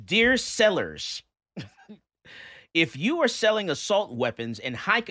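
theatrical oration of an angry letter; dramatic letter reading. Acted. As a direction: read the hate mail out with exaggerated emphasis. Reads a hostile letter aloud.

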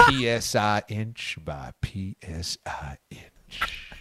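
slow Southern whisper, low and breathy; hushed, drawn-out Southern whisper; whispered southern drawl. Pretend. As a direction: whisper it like Southern pillow talk. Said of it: "Southern draws are fun".

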